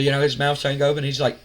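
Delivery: ordinary talk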